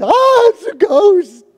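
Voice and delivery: very high, loud panicked shriek; fearful cry. Pretend. Pastor contrasting fearless and fearful reactions, terrified shriek. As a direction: shriek it in sudden terror. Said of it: "Or I go".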